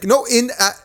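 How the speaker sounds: high, mock-earnest Scottish child; Scottish kid. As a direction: imitate a little boy with a Scottish accent. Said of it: "it's a Scottish kid".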